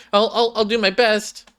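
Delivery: put-on high, nervous stuttering girl voice; high timid stutter; timid stammer. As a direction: stammer it out like a shy girl losing her nerve.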